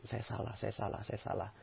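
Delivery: soft, fading self-reproach murmur; quiet murmured repetition. Shown as a demonstration. Teaching the audience to murmur saya salah softly. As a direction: murmur it under your breath, fading to nothing. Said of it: "Jadikan cermin ajaib".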